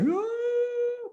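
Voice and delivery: long imitated child's scream; high-pitched mock scream. Son runs screaming at his father, imitated scream. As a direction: shriek like a kid in pain. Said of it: "I hear him screaming at the curb".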